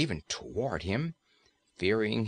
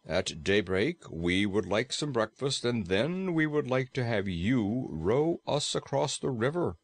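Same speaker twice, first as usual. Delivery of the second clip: Frogman voice. Acted. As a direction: speak as the proud Frogman, calm and formal.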